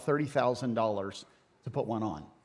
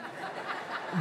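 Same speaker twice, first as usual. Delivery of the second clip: breathy laugh; 11 dB quieter than usual. Real, not acted. Joke about Tijuana weddings.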